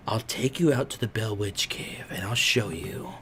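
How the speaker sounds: soft, slow, creepy whisper; creepy campsite owner offering a night tour; creepy old man. Retold or imitated. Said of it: "and he goes".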